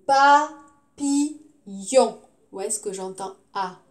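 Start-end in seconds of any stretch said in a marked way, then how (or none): 0.1-2.2 "Papillon" loud, slow pa - pi - yon; syllable by syllable. Shown as a demonstration. Teacher gives pupils a word, loudly syllable by syllable. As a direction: sound out "Papillon" in three separate beats.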